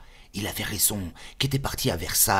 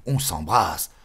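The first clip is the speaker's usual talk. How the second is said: ordinary delivery, nothing marked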